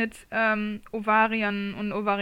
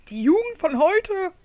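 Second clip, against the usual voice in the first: slow, higher, louder grumble about youth; grumpy old man; 7 semitones higher, 4 dB louder, slower than usual. Acted. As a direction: huff it out like a cranky grandpa. Mocking complaint about youth.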